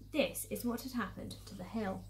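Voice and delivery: plain reading voice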